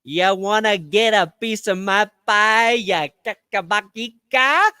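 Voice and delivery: put-on hammy Italian accent; mock Italian tough guy. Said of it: "my authentic Italian accent".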